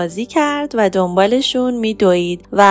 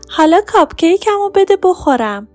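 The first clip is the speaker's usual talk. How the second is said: higher, greedy little cat voice; demanding kitten; 9 semitones higher, faster than usual. Acted. Hungry cat Lily demanding her cupcake, high and pushy. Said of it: "برگشت تو خونه و گفت".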